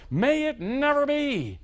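high, ringing exclamation; emphatic never. Retold or imitated. Preacher voicing Paul's strongest no, high and ringing. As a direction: cry it out in disbelief. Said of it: "the most emphatic expression of no".

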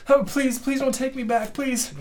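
high, frantic begging; a scared child begging not to go back; pleading boy. Acted. Slave boy pleading with the party, high and desperate.

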